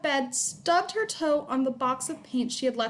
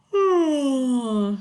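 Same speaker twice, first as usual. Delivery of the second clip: loud weary sigh; long sigh; 6 dB louder than usual. A narrator's reading. Father waking to fuss, loud sigh.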